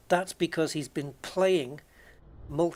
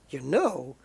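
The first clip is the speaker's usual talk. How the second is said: slow actor impression with swooping pitch; exaggerated halting mimicry; Christopher Walken. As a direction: draw it out like the film star. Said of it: "I can do the Christopher Walken".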